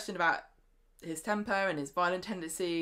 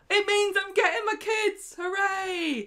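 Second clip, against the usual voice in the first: much higher, loud triumphant cheer; gleeful; 14 semitones higher, 6 dB louder than usual. Retold or imitated. Host acting Theo gloating over custody, high loud glee. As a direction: crow with fake joy.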